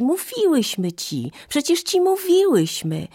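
put-on high, gleeful children's voices; joyful calling. A narrator's reading. Children remind mother they told her, calling out joyfully. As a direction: pitch it up high and happily insist. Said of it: "zawołały radośnie dzieci".

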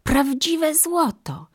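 slow, high cry of wonder; astonished exclamation. A narrator's reading. Mother marvels at real gold, high drawn-out exclamation. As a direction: draw it out high, amazed. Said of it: "wykrzyknęła".

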